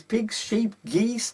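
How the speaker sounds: plain reading voice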